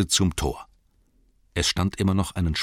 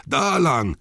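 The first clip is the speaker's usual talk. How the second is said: high raised orc yell; 8 semitones higher, 4 dB louder than usual. Acted. Orcs scattering in the hall, high yell. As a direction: shout the direction like an orc. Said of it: "schrien andere".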